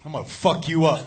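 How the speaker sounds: low, contained threat; mad buddy. Retold or imitated. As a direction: imitate someone quietly snapping. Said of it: "My buddy gets up mad".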